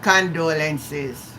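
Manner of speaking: "Condolences" drawn out very slowly and heavily; a sad, slow "Condolences"; weary emphasis. Real, not acted. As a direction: lean on "Condolences", slow and tired. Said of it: "I know my time we're gonna use this word".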